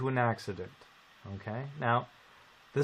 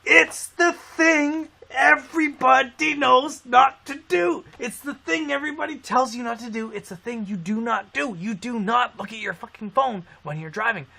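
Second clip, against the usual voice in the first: emphatic rant; 9 semitones higher, 5 dB louder than usual. Real, not acted. Ranting to friends about texting drivers, high and emphatic. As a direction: hammer home the obvious rule.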